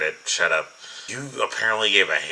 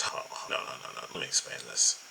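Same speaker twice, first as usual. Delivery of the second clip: hushed; 6 semitones lower, 11 dB quieter than usual. Real, not acted. Stops himself mid-rant.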